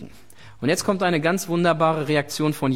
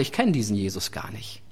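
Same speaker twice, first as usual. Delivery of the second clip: low, dismissive and softer denial; curt denial; 8 semitones lower, 4 dB quieter than usual. Acted. Peter denying Jesus after all he saw, low curt mutter. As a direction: shut it down quietly.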